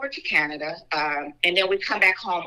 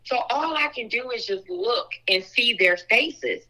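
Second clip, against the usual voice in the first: ordinary delivery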